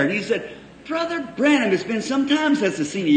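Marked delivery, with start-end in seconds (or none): 0.9-1.7 higher, lightly voiced husband's cheerful greeting; mock husband greeting. Preacher imitating the husband greeting him higher. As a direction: play the reconciled husband lightly.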